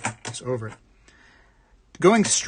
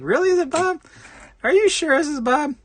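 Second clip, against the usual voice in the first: mock disbelief; 13 semitones higher, 3 dB louder than usual. Mocking agent's fake name.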